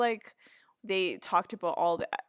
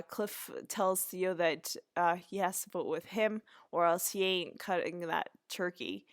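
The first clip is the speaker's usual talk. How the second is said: ordinary talk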